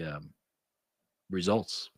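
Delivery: usual speaking voice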